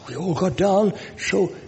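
normal talking voice

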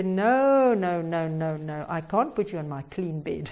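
string of no's sinking low and soft; mock dismayed refusal. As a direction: refuse with falling, fading voice. Boy refuses to bed dirty girl.

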